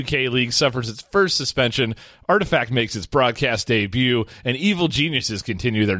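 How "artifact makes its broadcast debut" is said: ordinary talk, nothing marked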